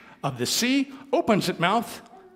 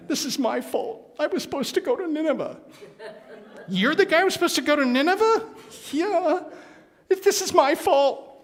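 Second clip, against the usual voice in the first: guilty Jonah; 13 semitones higher, 3 dB louder than usual. Acted. Jonah confessing, captain stunned.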